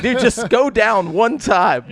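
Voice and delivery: loud, high, drawn-out comic plea; mock exasperation. Host begging upspeakers to drop pitch, mock exasperated. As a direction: plead slowly in hammed-up exasperation.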